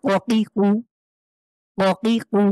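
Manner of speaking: ককিকু pushed from the tongue root; ককিকু with a firm push; pushed throat sound. Shown as a demonstration. Teacher models ককিকু, pushed from the throat. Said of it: "ধাক্কা দিয়ে আমরা পড়ব".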